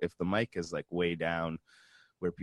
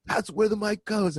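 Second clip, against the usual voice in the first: bossy sound tech; 6 dB louder than usual. Retold or imitated. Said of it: "he was like".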